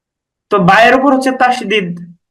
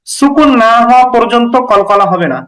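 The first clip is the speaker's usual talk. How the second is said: normal talking voice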